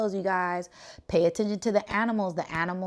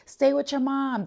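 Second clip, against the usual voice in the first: pleading with the fawns; 8 semitones higher than usual. Retold or imitated. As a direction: gently urge the little deer to stay close. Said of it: "I, I'm like".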